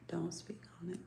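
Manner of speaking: hushed whisper of the quote; secretive whispered aside. Retold or imitated. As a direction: breathe it like a secret. Repeating the secret-keeping quote.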